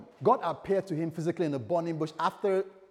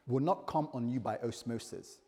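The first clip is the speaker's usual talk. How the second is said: ordinary talk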